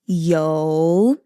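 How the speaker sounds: yo said slowly in Mandarin; Mandarin word. Shown as a demonstration. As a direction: stretch the yo, Mandarin tone. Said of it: "oil is yo".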